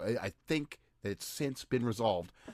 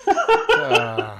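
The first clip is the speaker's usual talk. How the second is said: high laugh; 15 dB louder than usual. Real, not acted. Laughing at esoteric racism.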